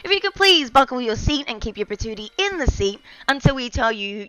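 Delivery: lightly hammed-up airline pilot over the intercom; mock pilot announcement. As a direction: play a pilot welcoming passengers aboard. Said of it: "I sound like a pilot".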